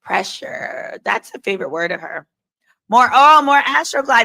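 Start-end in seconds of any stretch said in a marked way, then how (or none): 2.9-4.2 mocking voice. Hammed-up mocking chant.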